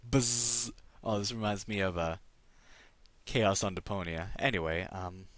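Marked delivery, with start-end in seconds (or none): 0.1-0.7 buzzing fly noise, slightly higher. Pretend. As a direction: buzz like a housefly. Imitating a fly again.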